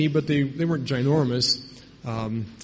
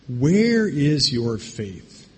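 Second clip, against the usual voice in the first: slow rebuke; much slower than usual. Acted. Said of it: "He says".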